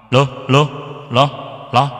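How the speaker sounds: short, separated test syllables; mic-check call. Shown as a demonstration. Teacher showing viewers the ringing sound, mic-check call. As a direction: sound out the mic in brief calls.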